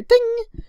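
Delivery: high sung chime noise; ping it out high, like a notification; imitated ding. Pointing viewers to an on-screen link, high vocal ding.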